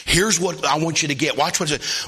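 ordinary talk

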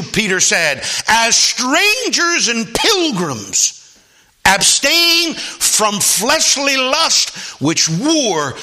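slow, drawn-out reading of scripture. Acted. Preacher reading Peter's letter to the church, slow and solemn. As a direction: stretch each phrase like holy writ.

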